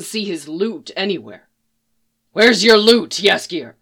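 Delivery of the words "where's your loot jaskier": shouted over the screams, louder and higher. A narrator's reading. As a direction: call it out hard over the wraith's screaming. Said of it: "Geralt shouted over his screams".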